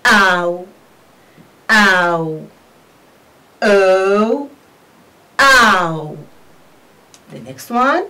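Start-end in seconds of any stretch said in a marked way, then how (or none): 0.0-2.5 ow drawn out as "aa-oo", lower and falling; long model ow, twice; diphthong demo. Shown as a demonstration. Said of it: "We pronounce it as". 3.6-6.2 O as "uh-oo", then Ow as "aa-oo"; stretched model O, Ow; diphthong drill. Shown as a demonstration. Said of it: "We pronounce it as".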